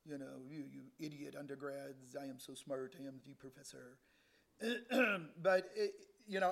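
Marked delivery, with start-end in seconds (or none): 0.1-4.0 lower, much softer sneering professor voice; a hint of a haughty professor looking down on students; mock arrogant professor. Preacher mocking the professor who sneered at undergrads, low and soft. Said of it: "These guys were, you know".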